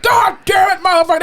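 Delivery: loud, high imitation of a swearing patient; mock cussing outburst. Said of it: "he would start cussing".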